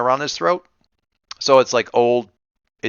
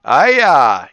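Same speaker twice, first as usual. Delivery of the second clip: drawn-out Maine "ayuh", higher and louder; Maine accent; 4 semitones higher, 7 dB louder than usual. Shown as a demonstration. Game master showing off the riders' Maine accent. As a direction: give it a quick Maine twang. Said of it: "have a Maine accent".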